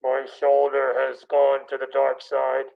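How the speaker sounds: patient doing a slow Vader voice; Darth Vader. Retold or imitated. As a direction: talk like Vader through a mask. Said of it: "sounds like Darth Vader".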